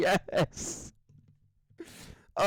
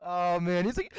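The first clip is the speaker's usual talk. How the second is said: normal talking voice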